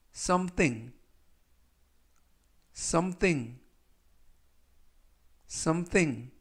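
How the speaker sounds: something said clearly, stress on the first syllable; model pronunciation. Shown as a demonstration. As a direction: say something slowly as a model word. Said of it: "이라고 발음하시면 되겠고요". (발음 시범으로 또박또박)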